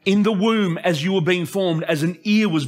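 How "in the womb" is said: usual speaking voice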